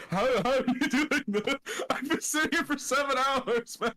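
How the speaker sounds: high, laughing mock surprise; mock voice of the friend who found him waiting; imitating friend. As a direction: squeak it out like a baffled buddy. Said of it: "He's like".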